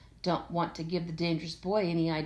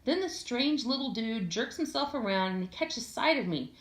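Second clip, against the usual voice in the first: plain reading voice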